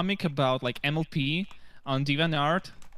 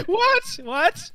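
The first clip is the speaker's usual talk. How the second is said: high, loud startled cry; 7 dB louder than usual. Real, not acted. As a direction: yelp it in disbelief. Startled by the German quote.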